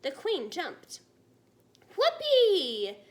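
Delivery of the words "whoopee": loud, high whoop of delight; joyful shout. A narrator's reading. Giant whoops at the Queen's breakfast, loud and high. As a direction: let it burst out big and happy. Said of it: "shouted the B F G".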